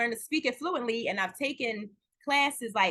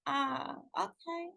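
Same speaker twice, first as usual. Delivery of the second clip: mom's reluctant okay; 8 dB quieter, slower than usual. Retold or imitated. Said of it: "And she's like".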